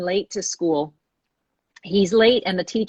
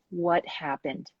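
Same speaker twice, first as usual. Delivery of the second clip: soft, slow, caring ask; gentle question; 2 semitones lower, 8 dB quieter, slower than usual. Retold or imitated. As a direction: ask it gently, no scolding at all. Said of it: "He didn't berate or scold that child".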